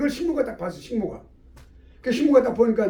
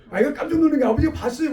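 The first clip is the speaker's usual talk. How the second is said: ordinary talk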